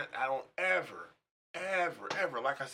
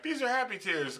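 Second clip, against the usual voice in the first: high mock girlfriend voice, a bit louder; 12 semitones higher, 5 dB louder than usual. Girlfriend explaining her tears to him, high mock voice. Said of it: "She always has to be like".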